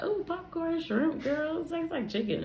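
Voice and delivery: lightly voiced child, proud of her snack; naive younger self. Third grader defending her popcorn shrimp, innocently. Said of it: "And I was like".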